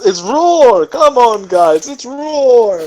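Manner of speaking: loud, high, stretched complaint; Gordon Ramsay. Retold or imitated. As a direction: berate the cooks like an angry chef. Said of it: "He just slams the pot".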